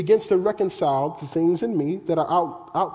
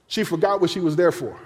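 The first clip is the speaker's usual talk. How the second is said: ordinary delivery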